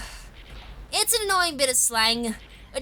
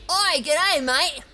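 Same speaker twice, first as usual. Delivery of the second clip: hammed-up Aussie greeting, higher and punchy; 5 semitones higher, 3 dB louder, much slower than usual. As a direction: lay on a mock broad Australian accent. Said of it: "we don't speak Aussie".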